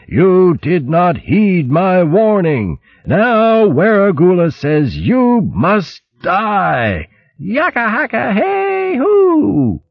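high, slow, drawn-out battle howl; angry booming howl. Acted. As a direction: howl it wildly, stretching the war cry. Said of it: "booming voice howled angrily".

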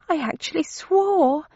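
high, small unhappy voice. A narrator's reading. Thursday shedding her disguise, unhappy at her own swearing, high-voiced. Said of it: "she muttered unhappily".

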